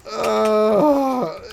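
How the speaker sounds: long, loud, high groan; exasperated groan. Real, not acted. Game master reacting to player's finger guns, exasperated groan. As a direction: groan loudly in disbelief.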